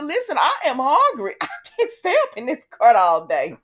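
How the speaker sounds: loud, high hammed-up grievance with a laugh; exasperated complaint. [name] complaining to Victor in the cart, mock exasperated. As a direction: act out a fed-up, hungry whine.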